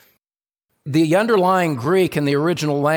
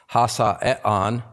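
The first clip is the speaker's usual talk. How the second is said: ordinary delivery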